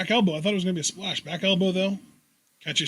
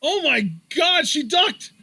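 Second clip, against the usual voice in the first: high excited yelling; excited shout; 10 semitones higher than usual. Real, not acted. Huge lariat exchange.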